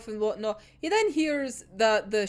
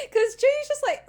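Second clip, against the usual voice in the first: ordinary talk, nothing marked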